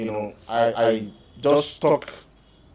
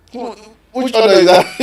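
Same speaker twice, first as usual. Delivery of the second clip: mock suspicious wife; 7 semitones higher, 9 dB louder than usual.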